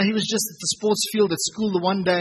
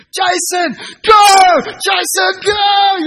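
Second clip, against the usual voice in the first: full-throated cheering shout, much higher and louder; 12 semitones higher, 11 dB louder, much slower than usual. Retold or imitated. Dad cheering son on from the trackside, loud shouting. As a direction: yell his name across the track like a proud dad. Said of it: "And I shouted".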